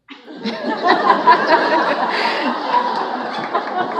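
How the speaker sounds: high, loud burst of laughter. Real, not acted. Honoree bursts out laughing at the family memory. Laughs after sister quote.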